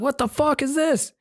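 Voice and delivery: high mocking voice of the annoyed inspector. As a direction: squeal it like a fed-up cop. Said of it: "He's like".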